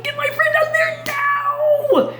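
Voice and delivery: high, loud, slow order; overjoyed king commanding Daniel freed; joyful command. Acted. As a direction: shout the rescue order happily.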